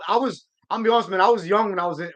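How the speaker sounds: ordinary talk, nothing marked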